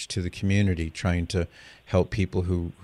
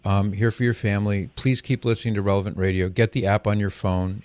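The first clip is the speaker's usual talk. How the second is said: normal talking voice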